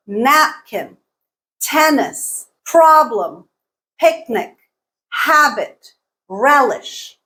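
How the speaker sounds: napkin, tennis, problem, picnic, habit, relish, each punched on its first syllable; first-syllable stress. Shown as a demonstration. Teacher giving noun examples, napkin to relish stressed on first syllables. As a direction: list napkin to relish word by word, first syllable strong, second falling. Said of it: "stress falls on the first syllable".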